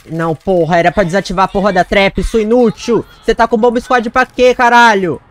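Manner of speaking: high, loud furious yelling at herself; angry shout. Real, not acted. Gamer furious at herself for setting off the trap, angry shout. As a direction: shout in rage at your own mistake.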